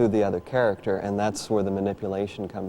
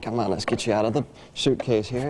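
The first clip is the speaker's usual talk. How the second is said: usual speaking voice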